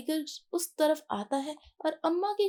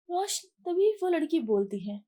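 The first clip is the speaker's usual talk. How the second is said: ordinary delivery, nothing marked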